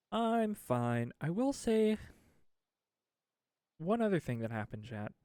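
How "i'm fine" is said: I'm fine popped up high; chirpy I'm fine. Pretend. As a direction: bounce I'm fine up brightly. Cheery repeat of I'm fine.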